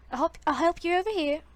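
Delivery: high, loud shop-counter call. Acted. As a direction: call across the store to a customer. Shop clerk calls out to customer.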